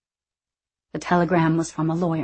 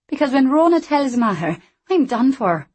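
higher, brighter character voice; lively young Scottish woman; 7 semitones higher, 5 dB louder than usual. Acted. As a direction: play her cheeky and quick. Anna voiced.

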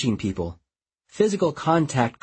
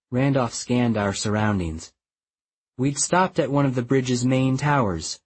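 ordinary delivery

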